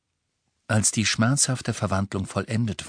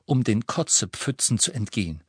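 ordinary delivery

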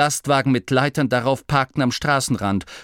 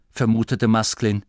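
ordinary delivery

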